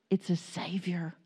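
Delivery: hushed, breathy whisper of the word savior. Acted. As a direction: drop to a secret whisper. Preacher drops to a whisper.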